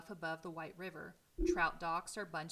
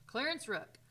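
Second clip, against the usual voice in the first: higher, louder, full of energy; old editor greeting his visitor, high and energetic; 6 semitones higher, 6 dB louder than usual. Acted. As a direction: introduce yourself like a spry old newspaperman. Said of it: "in a high-pitched, energetic voice".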